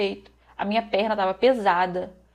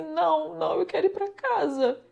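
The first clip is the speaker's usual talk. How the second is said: high-pitched, plaintive imitation; higher voice, as if whimpering in protest; retold tearful protest; 7 semitones higher than usual. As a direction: re-enact a weepy plea to leave. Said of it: "E eu chorava".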